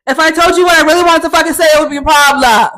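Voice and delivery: fast, high, loud rant; shouted heated outburst; angry shout. Acted. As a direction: yell it all in one rush. Venting about the quarrel.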